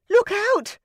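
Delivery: high, sudden shouted warning; sudden warning cry. A narrator's reading. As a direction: yell out a sudden alarm. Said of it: "Rosamond cried suddenly".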